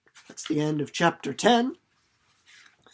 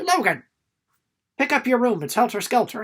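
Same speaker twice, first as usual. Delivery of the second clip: mom nagging; 5 semitones higher, 4 dB louder than usual. Retold or imitated. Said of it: "my mom will say".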